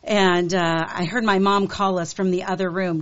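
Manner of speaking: ordinary talk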